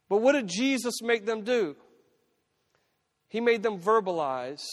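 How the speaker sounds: high, insistent sermon delivery; impassioned preaching. Acted. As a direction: press the point home to the congregation. Preaches with raised voice.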